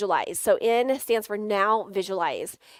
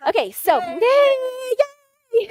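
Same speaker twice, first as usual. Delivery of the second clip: cheering; 8 dB louder than usual. Real, not acted. Cheering the volunteer's arrival.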